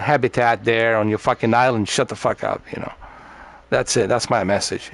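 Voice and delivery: ordinary talk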